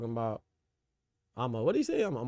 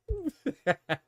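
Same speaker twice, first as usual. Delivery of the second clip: high mocking cackle. Real, not acted. Mocking viewer Jerry.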